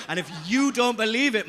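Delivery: ordinary talk, nothing marked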